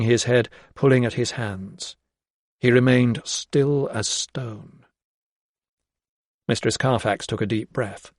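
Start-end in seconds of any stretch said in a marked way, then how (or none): none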